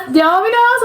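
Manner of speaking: a sudden high squeak of disbelief; shocked disbelief. Real, not acted. Reacting to a tourist wanting Domino's in Italy, with a high incredulous squeak. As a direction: pitch way up, you can't believe he asked.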